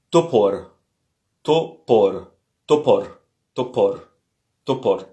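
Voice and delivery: topor drilled over and over, stress on the first O; repeated Russian word topor. Shown as a demonstration. Teacher drills topor for the learners.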